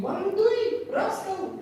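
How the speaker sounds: very high, loud imitated shriek; mother screaming. Mother to fireman throwing out her children, high mock scream. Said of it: "mother screaming".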